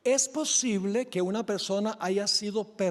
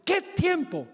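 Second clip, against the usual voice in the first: high, scornful retort; acted indignant refusal; 9 semitones higher, slower than usual. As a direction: pounce on the plea as the merciless creditor. Creditor angrily refuses the plea.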